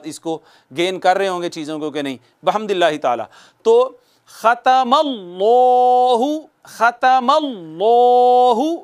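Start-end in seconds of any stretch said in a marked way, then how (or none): 3.6-8.8 ख़तमल्लाहु drawn out with a long stretched final; full slow recitation. Shown as a demonstration. Teacher reciting the complete word with all rules. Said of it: "देखें कितनी चीज़ें".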